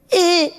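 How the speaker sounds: very high strained squeak; squeezed squeal. Retold or imitated. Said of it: "he'd make a sound".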